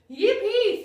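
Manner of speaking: a loud, high, delighted yippee; joyful cheer. Retold or imitated. The brain cheering as it finally gets its carbs, excited. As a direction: squeal it like a thrilled kid. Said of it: "your brain is going".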